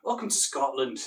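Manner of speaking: hearty Scottish greeting accent; swooping Scottish accent. Shown as a demonstration. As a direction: welcome them in a playful Scottish accent. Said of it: "I can do the Scottish".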